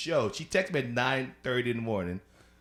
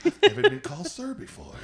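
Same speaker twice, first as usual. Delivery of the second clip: shocked; 5 dB louder than usual. Retold or imitated. Shocked reaction retold.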